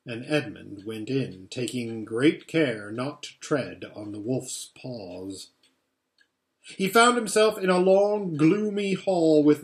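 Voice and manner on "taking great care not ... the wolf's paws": ordinary delivery, nothing marked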